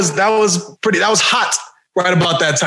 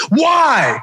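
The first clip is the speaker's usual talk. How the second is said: normal talking voice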